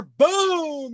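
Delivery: sudden high, drawn-out boom; gunshot imitation. Gun goes off inside the truck, explosive. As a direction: cut in with an explosive mimicked bang.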